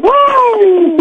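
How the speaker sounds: a high, yelled wrestler woo; Ric Flair woo. Retold or imitated. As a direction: belt out a wrestler's signature woo. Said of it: "Ric Flair going".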